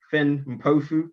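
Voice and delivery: ordinary delivery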